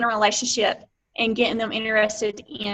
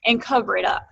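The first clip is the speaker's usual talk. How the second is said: normal talking voice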